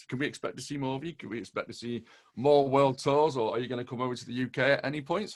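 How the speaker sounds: fast run of questions; speaking very quickly. Real, not acted. Interviewer asking a foreign guest about tour plans, very quick. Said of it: "you are speaking very quick".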